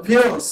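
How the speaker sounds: ফিউলস as "fyools", set apart; English pronunciation. Shown as a demonstration. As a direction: model ফিউলস with a fyoo glide and a fall. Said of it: "উচ্চারণ কি?". (ফিউলস, ইংরেজি ধাঁচে ফিউ-লস উচ্চারণ)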